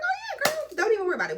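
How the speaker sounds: very high-pitched, breezy reassurance; over-eager agreement. Retold or imitated. Driver agreeing to the partner's chosen stop, over-eager agreement.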